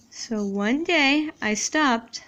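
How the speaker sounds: slow dictation. Shown as a demonstration. Rereads while writing slowly.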